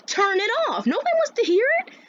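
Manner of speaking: very high, rushed mad outburst; acted indignant squeal, quickening; really mad. Student mad at being singled out, high-pitched protest. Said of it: "I just got really mad".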